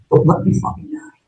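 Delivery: breathless, voiceless plea; desperate whisper. Retold or imitated. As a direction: whisper it like your last breath. Begging sergeant while badly wounded.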